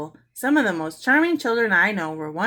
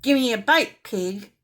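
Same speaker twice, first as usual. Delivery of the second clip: rudely squawked demand from a cheeky parrot; 4 semitones higher, 6 dB louder than usual. Acted. Parrot rudely demanding a bite from Mary. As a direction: bark it like a bossy bird. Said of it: "said rudely".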